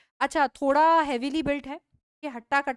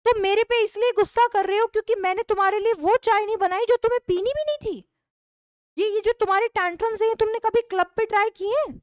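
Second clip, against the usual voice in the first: higher and shrill-pitched, mocking his tantrums; scolding wife; 8 semitones higher than usual. Wife to husband about his tantrums, high mocking scold. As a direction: taunt him for sulking over tea.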